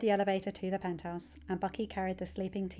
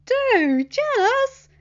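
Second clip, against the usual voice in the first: much higher, loud jealous squeal; excited cry; 13 dB louder, much slower than usual. Acted. Best friend squealing with envy over the photos, excited. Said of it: "Ned cried".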